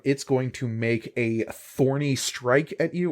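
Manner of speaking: ordinary talk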